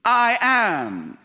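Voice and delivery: "I am", lifted and ringing; solemn divine claim. Acted. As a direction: proclaim it as God would.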